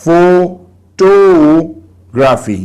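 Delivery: "photography" very slow, long loud TO; teacher sounding out pho-TO-graphy with big pauses; syllable stress demo. Shown as a demonstration. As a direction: break up "photography", punching and holding TO. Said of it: "Stress words".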